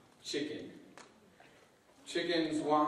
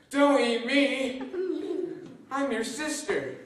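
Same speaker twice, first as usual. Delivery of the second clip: chicken voice; 9 semitones higher, slower than usual. Acted. Chicken pleads with crocodile.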